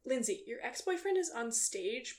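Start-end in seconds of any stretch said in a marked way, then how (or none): none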